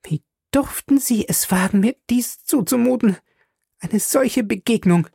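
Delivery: high female voice, indignant, opening in a whisper; angry young woman. Acted. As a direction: reproach him as an offended lady. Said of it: "ihre sanften Augen sprühten vor Zorn". (empörte junge Frau, hohe Stimme)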